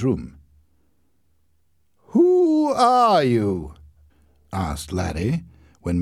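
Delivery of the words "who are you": high, very slow, befuddled question; drunk confusion. A narrator's reading. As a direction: slur out bewilderment. Drunk man to his mother.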